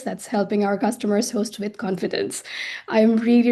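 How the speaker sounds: normal talking voice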